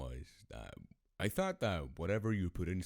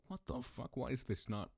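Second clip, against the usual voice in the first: higher, lightly puzzled voice; mock baffled dad; 8 semitones higher, faster than usual. Dad trying to start his motorcycle, mildly baffled. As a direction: act out confusion at a higher pitch.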